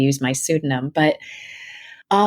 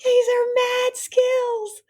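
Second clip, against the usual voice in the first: delighted echo; much slower than usual. Real, not acted. Echoes host's compliment, laughing.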